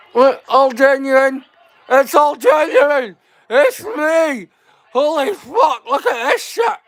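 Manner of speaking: high, shouted disbelief; amazed outburst. Real, not acted. Streamer startled at his on-screen look, high shout. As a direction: blurt it out, delighted and stunned. Reacts to his own face.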